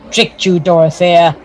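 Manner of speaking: ordinary talk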